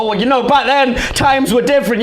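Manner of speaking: high, protesting self-justification; mock-defensive excuse; making excuses. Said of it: "I can try and make an argument".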